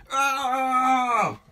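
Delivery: high loud yelp from cold bathwater; a sudden high cry at the icy bath; shocked yell. Acted. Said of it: "he yelled".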